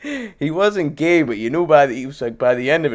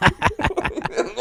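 high, cackling real laugh; real laugh, pitched high; high burst of laughter; 12 semitones higher, 4 dB quieter than usual. Real, not acted. Laughing at his own story.

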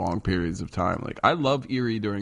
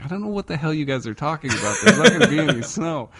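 laughing; 7 semitones higher, 6 dB louder, faster than usual. Retold or imitated. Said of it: "I was like".

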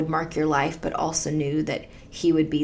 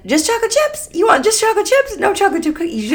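much higher, louder, puzzled husband; confused husband; 7 dB louder, faster than usual. Retold or imitated. Husband puzzling over a wordless request, high and loud. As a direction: play him baffled by the request. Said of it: "And he said".

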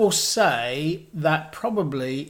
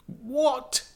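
surprised; 9 semitones higher, 3 dB quieter than usual. Real, not acted. Shocked at the low ranking.

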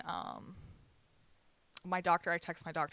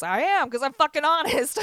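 punchy, raised emphatic voice; emphatic loud; 7 semitones higher, 10 dB louder than usual. Real, not acted. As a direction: belt out the last words. Emphatic outburst about honesty.

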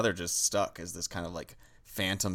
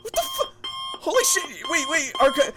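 squeaky pitched-up voice; helium-high panic; chipmunk voice; 4 dB louder than usual. Acted.

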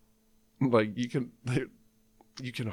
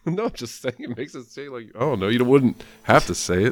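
normal talking voice

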